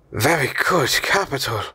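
understated satisfaction; pleased murmur. A narrator's reading. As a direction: murmur in a put-on uncle voice. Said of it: "murmured my uncle".

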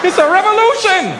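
high mock revolutionary shout; mock rebel cry.

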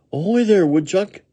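high, loud puppy hail; crying out. A narrator's reading. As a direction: call out to catch their attention. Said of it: "he cried".

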